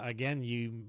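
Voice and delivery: usual speaking voice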